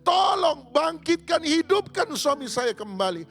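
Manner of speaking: wailing plea. Acted. Widow confronting the pastor with his sermon, wailing. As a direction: throw his own sermon back at him, wailing.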